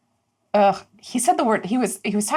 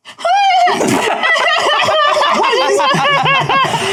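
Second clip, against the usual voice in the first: high, loud comic wail; wailing sob laugh; 5 dB louder than usual. Acted. Dieth bursting into tears over the pie, a high loud wail. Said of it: "literally just starts sobbing".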